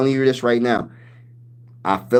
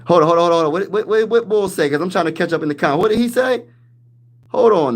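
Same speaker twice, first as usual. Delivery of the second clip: surprised; 6 semitones higher, 3 dB louder, faster than usual. Real, not acted. Reacting to a comment in chat.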